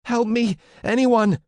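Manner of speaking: very high, loud cry for help; piercingly high panicked scream; screaming lungs out. A narrator's reading. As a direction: scream desperately for anyone to come. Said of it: "I was screaming my lungs out".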